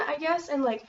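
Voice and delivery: usual speaking voice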